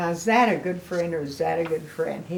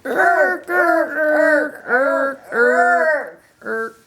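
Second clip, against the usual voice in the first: high, loud retching irks; leading children in gagging noises; sick noises; 8 semitones higher, 8 dB louder, much slower than usual. Shown as a demonstration. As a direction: gag loudly for the kids to copy. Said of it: "Come on, do that with me".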